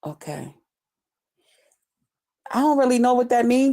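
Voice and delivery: normal talking voice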